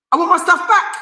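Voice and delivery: high, insistent demand for his stuff back. Real, not acted. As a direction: push the word back up high. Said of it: "repeated demand, first take".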